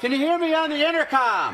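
high-pitched holler through the house; yelling to be heard. Acted. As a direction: holler it to someone in another room. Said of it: "all this yelling".

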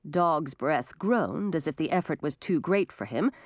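plain reading voice

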